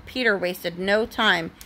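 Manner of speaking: ordinary delivery, nothing marked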